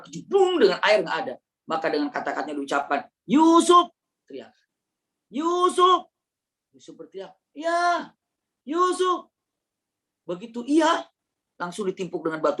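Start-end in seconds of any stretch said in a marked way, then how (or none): 3.2-3.9 much higher, loud call down a well. Acted. Brothers calling down the well. 5.3-6.1 much higher, loud call down a well. Acted. Calling down the well. 7.6-9.3 calling out. Acted. Calling down the well.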